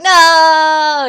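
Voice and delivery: sudden loud shocked no; shouted no. Retold or imitated. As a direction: blurt it out loud in alarm. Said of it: "And I was like".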